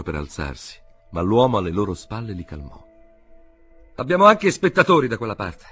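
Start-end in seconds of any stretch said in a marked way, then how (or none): 4.0-5.6 high loud shout to the inspector. A narrator's reading. K shouting to the inspector about onlookers. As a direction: call it out, pointing outside. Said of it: "gridò K all'ispettore".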